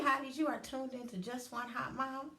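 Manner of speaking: ordinary talk, nothing marked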